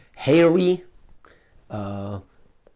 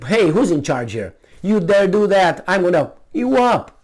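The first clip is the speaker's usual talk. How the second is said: mock threat; 5 semitones higher, 5 dB louder than usual. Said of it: "and say".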